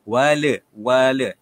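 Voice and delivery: "wal" with a loud rebounding l; "wal" clipped with a bounce on the lam; bounced lam. Shown as a demonstration. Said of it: "bacaan yang kurang tepat".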